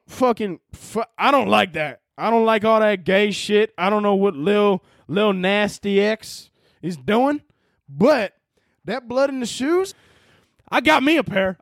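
hammed-up Southern drawl; good Southern accent. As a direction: play it slow and Southern. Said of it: "let me get into my good Southern accent".

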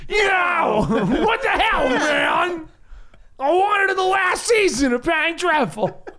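high whiny fanboy voice, laughing; slow, high whine of an upset fan; internet voice. Pretend. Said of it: "Your internet voice is weird".

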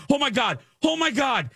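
very high retold panic; panicked cry. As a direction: squeal it as if caught out, acting. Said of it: "I'm screaming Frank as loud as I".

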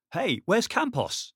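high, urgent worried question; concerned. Acted. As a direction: ask it with real concern. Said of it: "with real concern in his voice now".

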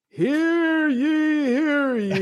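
put-on high, booming old-time proclamation; town crier. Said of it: "what character is that?".

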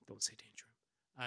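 hushed quick whisper; a fast whisper to yourself; breathless whispered correction; whispered aside. Real, not acted. Whispered aside mid-recap.

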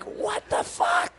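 slow, breathless whispered disbelief; unvoiced shocked whisper, drawn out; stunned whisper. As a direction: gasp it out in shock. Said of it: "And I'm like".

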